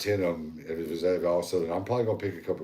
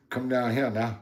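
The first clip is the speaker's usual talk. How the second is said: high twangy mock Southern call; Southern drawl; 6 semitones higher than usual. Guy imitating West Georgia folks, Southern accent calling. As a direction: call them in like an old Appalachian country voice. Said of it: "they'll say".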